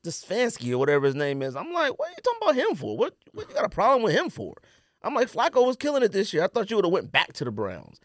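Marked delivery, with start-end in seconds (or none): none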